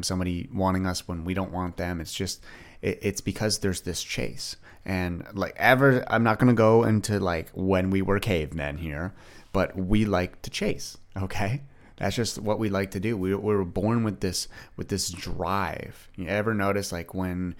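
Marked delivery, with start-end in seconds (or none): none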